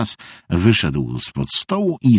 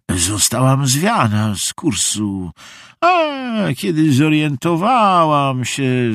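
put-on creaky old visitor; 6 semitones higher than usual. A narrator's reading. Visitor explains being blown off course, creaky voice. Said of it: "skrzypienie nienaoliwionej furtki".

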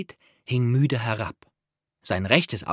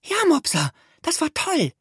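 put-on very high, small girlish creature voice; sehr hohe, bewundernde Stimme; Perle praising. A narrator's reading. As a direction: squeak out the praise like a tiny admirer. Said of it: "lobte Perle".

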